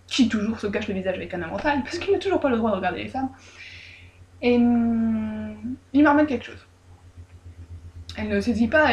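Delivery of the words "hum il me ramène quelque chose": ordinary talk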